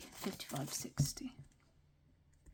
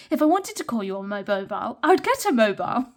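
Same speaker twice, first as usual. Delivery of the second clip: put-on British accent; 9 dB louder, faster than usual. Retorting in a retold Friends scene, hammed-up British accent. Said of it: "a British person trying to do a British accent".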